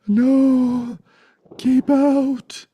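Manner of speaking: high, loud frantic keep-out; panicked refusal. Acted. Teacher mimicking the fearful ego at the door, high frantic cry. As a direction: shriek it like a scared homeowner.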